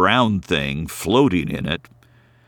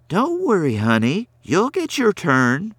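higher, lightly voiced helper; soothing elf; 9 semitones higher than usual. A narrator's reading. Elf comforting the crying girl, lightly higher and soothing. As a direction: reassure a small child.

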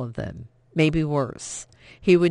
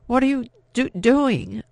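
higher, louder startled question; alarmed cry; 8 semitones higher, 6 dB louder than usual. A narrator's reading. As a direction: blurt it out in sudden alarm. Vianne alarmed at the search.

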